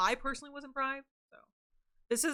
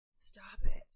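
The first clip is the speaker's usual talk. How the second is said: breathy stop it, whispered. Pretend. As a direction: whisper it close to the mic.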